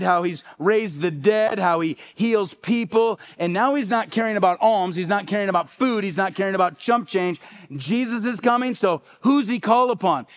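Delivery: ordinary talk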